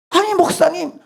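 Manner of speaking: put-on young man balking at the order; protesting youth.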